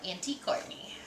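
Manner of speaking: breathy whispered sign-off. Real, not acted. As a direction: whisper the name softly, like a secret. Reading the birthday card softly.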